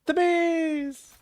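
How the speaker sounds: high, put-on squeal; mock bee alarm. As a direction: squeal it like a comic panicked cry. Replaying the elephant bee alarm.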